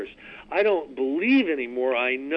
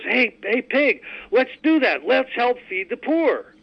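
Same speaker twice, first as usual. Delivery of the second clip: eager chicken; 8 semitones higher than usual. Acted. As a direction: pitch the chicken up and coax the pig. Chicken voiced in a joke.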